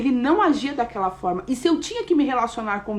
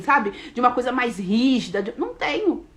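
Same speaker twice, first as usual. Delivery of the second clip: ordinary talk, nothing marked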